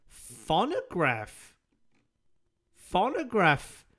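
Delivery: phonograph stretched out slowly and loudly; emphatic correction. Shown as a demonstration. Correcting with phonograph as the real word, emphatic. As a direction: boom phonograph, dragging each syllable. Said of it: "phonograph is a word".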